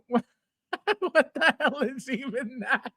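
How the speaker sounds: can't hold back a laugh at how bad it looks; giggling incredulous question; laughing disbelief. Real, not acted. Laughing at the figure again.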